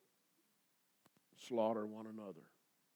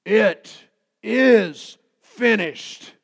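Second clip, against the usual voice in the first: very slow, loud, high triumphant shout; cry of victory; 7 semitones higher, 14 dB louder, much slower than usual. Retold or imitated. Jesus on the cross, shouting in loud triumph. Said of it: "It was a cry of victory".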